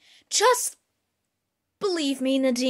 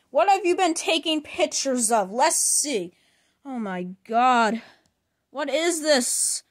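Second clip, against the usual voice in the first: ordinary talk, nothing marked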